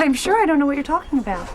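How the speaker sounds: loud, high-pitched protest; indignant denial. Acted. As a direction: act affronted and deny it all. Mother denies daughter's accusation.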